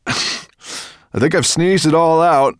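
high, loud, slow call; calling back. A narrator's reading. Firedrake answers his passengers, calling back. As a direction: call slowly to someone behind you. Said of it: "he called back".

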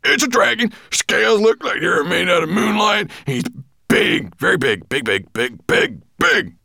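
high, loud, excited dwarf; frantic insistence. A narrator's reading. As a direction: shout it out, piling up the words.

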